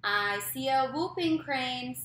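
slow, loud sing-song read-aloud; reading to a toddler. A narrator's reading. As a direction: read it slow and bouncy for little kids. Reads picture book aloud to kids.

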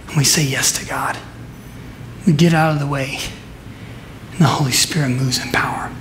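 hushed whisper. Acted. Sermon point dropped to hush.

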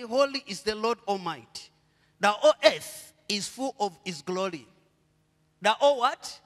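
ordinary talk

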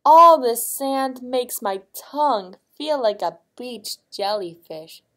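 queen's loud complaint, falling away at the end; complaining queen. A narrator's reading. Queen at breakfast with sand on her tongue, complaining. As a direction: complain loudly, starting high and dropping off. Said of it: "complained the queen".

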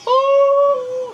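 very high, loud oh; shocked yell. Real, not acted. Player shocked by a brutal hit. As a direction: gasp out in shock.

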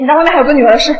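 normal talking voice

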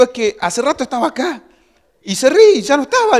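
high, loud, agitated explaining; acted flustered protest. Husband explaining to wife that she had vanished, acted flustered. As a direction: plead the case in mock panic.

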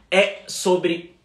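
ordinary delivery

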